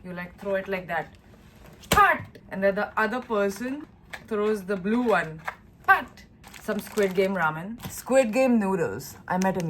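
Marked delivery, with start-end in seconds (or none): 1.9-2.4 sharp, high, loud "pat"; imitated slap sound. Shown as a demonstration. Showing viewers how the game is thrown, sudden slap sound. 5.9-6.2 sharp, high, loud "pat"; imitated slap sound. Shown as a demonstration. Showing viewers how the game is thrown, sudden slap sound.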